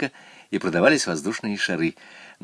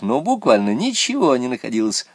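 usual speaking voice

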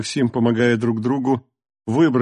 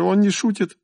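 higher, urgent alarmed voice; frightened warning; 7 semitones higher than usual. A narrator's reading. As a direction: warn them in panic. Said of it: "крикнула Кира".

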